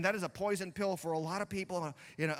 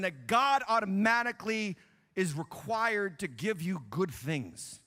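ordinary talk

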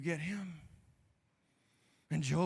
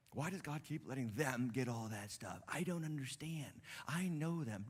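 jealous muttering; 5 semitones lower, 9 dB quieter than usual. Acted. Voicing jealous believer.

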